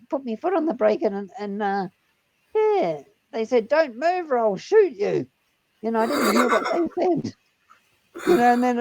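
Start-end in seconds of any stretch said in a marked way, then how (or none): 3.7-5.3 slow, firm retold police order; mock police command. Armed police warn the driver, slow mock command.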